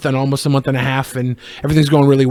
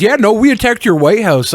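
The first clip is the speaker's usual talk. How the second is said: mock Canadian; 6 semitones higher, 4 dB louder than usual. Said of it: "Canada says".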